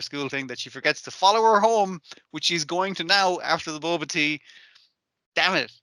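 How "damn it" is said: usual speaking voice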